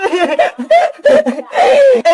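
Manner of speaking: high, swooping laugh; high giggle. Acted. Girls hoping for food in the parcel, high giggle. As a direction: giggle up at the top of the voice.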